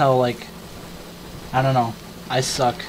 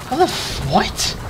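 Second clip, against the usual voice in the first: much higher, cut-off disbelief; baffled; 5 semitones higher, slower than usual. Retold or imitated. Hearing the thumb trick, cutting off in high confusion. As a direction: stop mid-curse in confusion. Stunned by friend's method.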